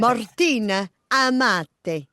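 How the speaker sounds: slow, careful Italian accent on "Martina Amati"; corrected Italian pronunciation, each name lingered on. Shown as a demonstration.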